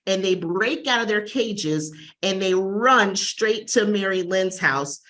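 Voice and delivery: ordinary talk, nothing marked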